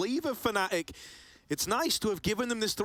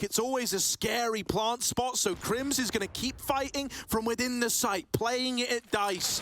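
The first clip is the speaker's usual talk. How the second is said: normal talking voice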